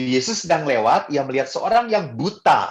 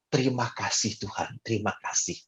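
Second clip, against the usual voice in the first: quiet prayer; 4 semitones lower, 10 dB quieter than usual. Retold or imitated. Thanking God each morning.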